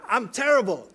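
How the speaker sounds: loud, high mock yell of frustration; a boy's frustrated outburst, mimicked loudly; imitating a frustrated scream. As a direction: yell it like a kid missing every shot. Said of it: "he screamed".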